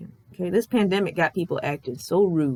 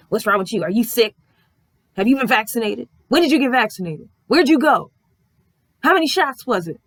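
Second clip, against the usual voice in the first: rapid-fire questions, louder and pushier; imitating a rude interrogation; 4 semitones higher, 5 dB louder than usual. Therapist grilling patient about vaccines, mock rude. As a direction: grill him like a suspect. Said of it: "the attitude I'm emulating and I'm imitating here".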